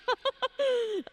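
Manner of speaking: high-pitched laughter falling away. Acted. Visitor reacting to a nice neighborhood, laughing high. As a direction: burst of high laughter, descending.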